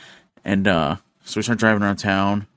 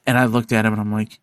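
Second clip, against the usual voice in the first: normal talking voice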